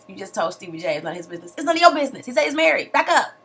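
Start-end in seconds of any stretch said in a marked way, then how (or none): 1.6-3.3 loud, sassy scolding; loud sass. Real, not acted. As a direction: tell her off to back up. Heated rant defending married man.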